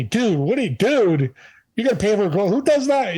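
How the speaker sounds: high, disbelieving dude voice; incredulous clerk. Retold or imitated. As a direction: play the baffled clerk who can't believe it. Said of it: "And he's looking at me".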